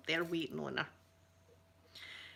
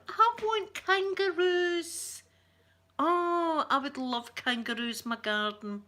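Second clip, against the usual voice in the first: high, loud excited squeal, easing toward the end; drawn-out, high-pitched delighted wish; gushing longing; 9 semitones higher, 4 dB louder, slower than usual. Retold or imitated. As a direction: gush like a kid begging for a pet. Retells own gushing at kangaroos.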